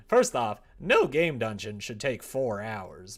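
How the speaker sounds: loud, slow indignant complaint; loud rant. Real, not acted. Gamer ranting at the overlong game dungeon, loud.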